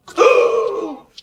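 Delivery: very high, loud gasp; shocked gasp. Acted. Kid's shocked gasp at the mailman news.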